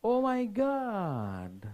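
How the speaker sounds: high, hugely drawn-out exclamation; mock amazement. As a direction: feign astonishment, stretching the last word. Feigned amazement at result. (खींचकर बनावटी हैरानी)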